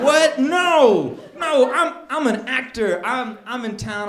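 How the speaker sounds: loud, high flustered protest; indignant denial. Retold or imitated. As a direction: act out a shocked, hasty denial. Acting indignant denial of being homeless.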